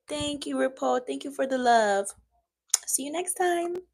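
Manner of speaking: cheery high-pitched thank you; squeaky thanks. Real, not acted. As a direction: gush at the viewer up high. Thanking viewer again.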